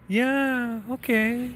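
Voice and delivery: high, soft sulky mimicry; slight pout. Manager greeted by applicant who declined, mock pouting. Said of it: "Like, pouting a little bit".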